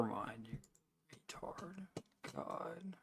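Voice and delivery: whispered insult under breath; whispering. Real, not acted. Muttered insult after typing.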